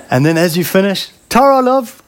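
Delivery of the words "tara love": much higher, sing-out mock goodbye; friendly local cashier. Cashier as you finish at the supermarket, imitated high, friendly goodbye. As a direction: imitate a chirpy local farewell.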